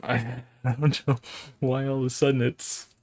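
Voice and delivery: laughing. Real, not acted.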